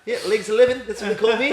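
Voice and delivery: high-pitched, loud Kiwi comic impression; New Zealand accent. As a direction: imitate a New Zealand comedy character. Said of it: "he's, like, New Zealand".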